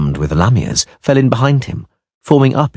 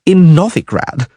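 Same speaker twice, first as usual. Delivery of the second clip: high, louder whining; whine; 12 semitones higher, 4 dB louder, slower than usual. A narrator's reading. Dandelion arguing with the dwarf in a whine. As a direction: complain in a pitched-up whine. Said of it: "Dandelion whined".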